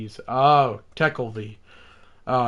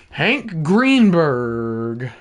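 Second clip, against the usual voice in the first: slow, drawn-out play-by-play; announcer call; 4 dB louder, much slower than usual. Pretend. A kid calling the pitch to viewers, announcer voice. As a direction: lay out the pitch like a broadcaster.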